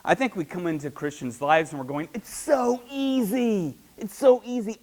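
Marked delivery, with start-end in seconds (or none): 2.1-3.7 higher, slow, drawn-out sell; drawn-out salesy. Retold or imitated. Church member reassuring a newcomer, drawn-out salesy. Church selling easy faith. 4.0-4.8 higher, louder quick "It's so easy"; emphatic salesy. Retold or imitated. Church member reassuring a newcomer, brisk and emphatic.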